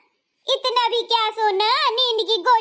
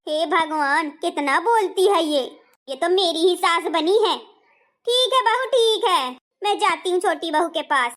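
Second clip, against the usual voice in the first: ordinary delivery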